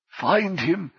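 usual speaking voice

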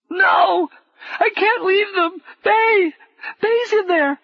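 high, loud sobbing cry; a child sobbing, begging to go back; sobbing plea. A narrator's reading. Sobbing boy begs Paul to let him save Bay. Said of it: "The boy was sobbing so convulsively".

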